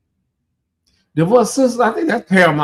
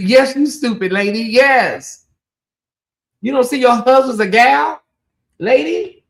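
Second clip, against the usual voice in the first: higher, exasperated mock scolding; exasperated rebuke; 6 semitones higher than usual. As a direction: tell off the clueless lady, pitched up. Mock rebuke of the show's wife.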